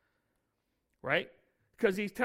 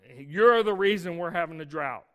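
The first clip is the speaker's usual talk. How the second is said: mock accusation; 9 dB louder than usual. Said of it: "Ahab, want".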